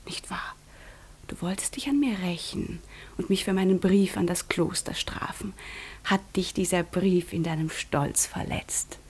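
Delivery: urgent, intimate whisper; intense whisper. Acted. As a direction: whisper it close, pressing the point. Intimate accusation. (eindringliches Flüstern)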